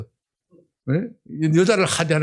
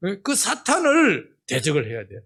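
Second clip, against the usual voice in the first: ordinary talk, nothing marked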